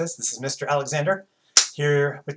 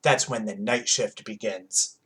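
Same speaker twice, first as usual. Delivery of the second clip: ordinary delivery, nothing marked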